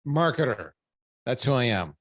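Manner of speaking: ordinary delivery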